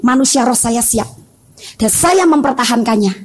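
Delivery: ordinary talk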